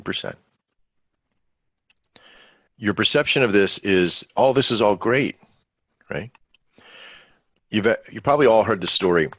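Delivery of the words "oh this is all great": higher-pitched, upbeat mock trader's reaction; pleased, raised-pitch quote; enthusiastic. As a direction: act out a newcomer thinking it's all wonderful. Acts out trader's rosy perception.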